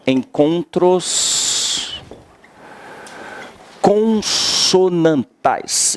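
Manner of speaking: "encontros consonantais" muito lento, palavra por palavra; ditando devagar. Shown as a demonstration. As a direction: dite "encontros consonantais" bem pausado para anotar. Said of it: "Anota já assim". (ditado lento de professor)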